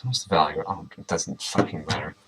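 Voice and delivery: ordinary talk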